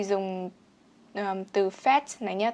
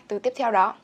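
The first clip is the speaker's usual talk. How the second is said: normal talking voice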